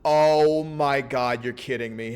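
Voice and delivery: loud, slow, drawn-out oh my god; stunned disbelief. Real, not acted. Streamer seeing her face, loud drawn-out shock. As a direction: gasp it out in shock.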